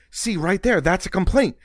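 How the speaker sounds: hint of a proud father pointing it out; proud dad. Son imitating his dad praising a complaint, mildly pleased. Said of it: "He goes".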